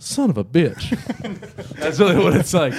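laughing exasperated curse. Real, not acted. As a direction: crack up at being caught out. Caught out, laughing.

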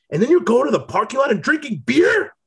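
high, shouted outraged question; mock angry coach. Imitating the coach scolding the team about beer, high shout. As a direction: yell it like the furious coach.

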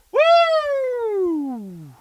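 long, very high-pitched whoop; high cheer. Pretend. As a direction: let out a drawn-out falsetto woo. Cheering the gossip news.

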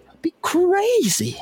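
high, stretched-out squeal of delight; squeaky excited. Real, not acted. Cohost squealing about a WWE merch stand, slow and high. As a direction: squeal it slowly in disbelief.

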